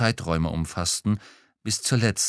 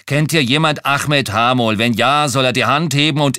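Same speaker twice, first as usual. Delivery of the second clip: raised, forceful challenge to the room; loud fed-up ultimatum; angry loud demand; 5 semitones higher, 8 dB louder than usual. A narrator's reading. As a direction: bark it at the whole table. Fed-up narrator challenges card players.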